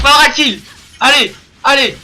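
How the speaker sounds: loud cheering call; excited esports caster. Real, not acted. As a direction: cheer the team on loudly. Caster cheering the final kills.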